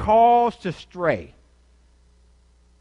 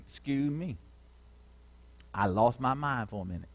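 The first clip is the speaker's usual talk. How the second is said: much softer, lower meek apology; sheepish Job; 8 semitones lower, 12 dB quieter than usual. Acted. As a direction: mumble it, embarrassed. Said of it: "And Job said".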